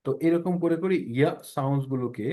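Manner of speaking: ordinary talk, nothing marked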